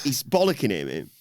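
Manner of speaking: ordinary talk, nothing marked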